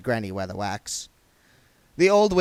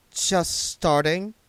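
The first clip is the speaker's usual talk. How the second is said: mocking; 8 semitones higher, 4 dB louder, much slower than usual. A narrator's reading. Diamanda mocking Granny's level.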